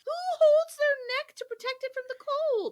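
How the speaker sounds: high, loud disbelieving question; incredulous outburst. Real, not acted. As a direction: fire it off in disbelief. Incredulous at Mina's excuse.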